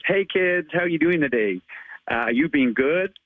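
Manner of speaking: a Brit doing his best American accent as Spider-Man; amateur American accent. Shown as a demonstration. Asked by the host, he plays Spider-Man greeting kids in a hammed-up American accent. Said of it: "your American accent as Spider-Man".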